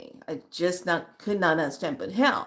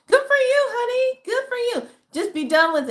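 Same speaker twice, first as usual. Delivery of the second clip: much higher, louder cheering voice; high praise; 5 dB louder than usual. Real, not acted. Praising the follower's degree.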